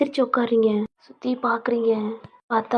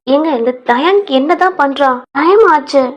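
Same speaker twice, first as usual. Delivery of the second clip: loud call across the house; 4 semitones higher, 9 dB louder than usual. Acted. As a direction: raise the voice to reach the other room. (வீட்டுக்குள் சத்தமாக அழைப்பது)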